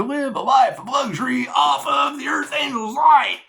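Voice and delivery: high, loud outburst; rising into a shout; ranting shout. Real, not acted. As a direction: shout the end of the rant. Heated rant peaks.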